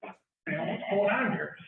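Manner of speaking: high-pitched, incredulous mock reaction; retold bewilderment. Retelling own bewildered reaction at scene.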